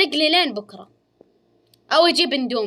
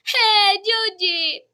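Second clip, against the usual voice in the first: long high singsong "هي جوجي"; high drawn-out call; 10 semitones higher, 4 dB louder, much slower than usual. Real, not acted. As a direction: stretch "هي جوجي" slow and high. Calling the character, high.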